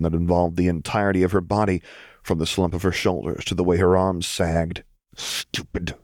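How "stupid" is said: "Stupid" mumbled much softer and falling. Acted. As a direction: mutter it under the breath. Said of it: "Jinx mumbled".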